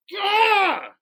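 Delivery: loud, high burst of a yell; frustrated yell. Retold or imitated. Man retelling the moment he had enough, frustrated yell. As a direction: let out a wordless snapping yell. Acts out snapping during the shove.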